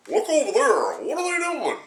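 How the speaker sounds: high, far-off gawking onlooker; high observer voice. Retold or imitated. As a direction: call out like a startled bystander. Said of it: "just as an observer going".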